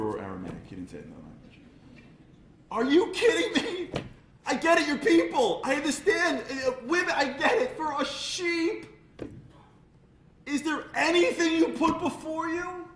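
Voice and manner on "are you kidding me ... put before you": amazed outburst. Retold or imitated. God exclaims over the sheep.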